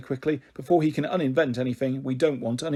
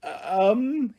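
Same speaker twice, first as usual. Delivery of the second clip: stammering; 6 semitones higher than usual. Acted. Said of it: "he stammers".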